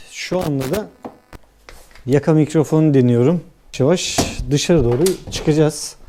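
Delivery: ordinary talk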